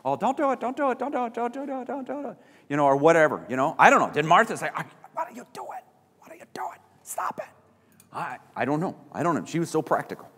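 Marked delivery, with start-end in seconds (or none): 0.1-2.4 high, fading mock chatter; high-pitched babble trailing away softer; chattering voices. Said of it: "they're chattering everywhere". 4.7-7.5 high, squeaky whisper as Martha; tiny, hushed mock protest; whispered scolding. Acting Martha scolding under her breath.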